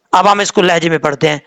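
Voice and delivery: ordinary delivery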